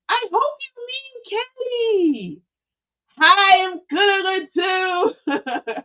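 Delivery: very high, stretched-out words; playful squealed greeting. Real, not acted. Host answers a viewer's greeting, playful high squeal.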